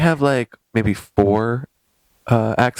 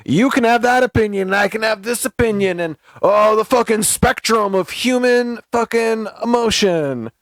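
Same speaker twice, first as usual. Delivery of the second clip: higher, dreamy, slowing at the end; Matthew Silver parody; 5 semitones higher than usual. Retold or imitated. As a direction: parody a hippie performance artist's sermon. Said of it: "he would hear that and go".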